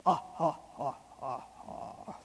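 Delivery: low mock-evil giant laugh fading out; hammed-up villain cackle for kids; maniacal laugh. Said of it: "Cue maniacal laugh here".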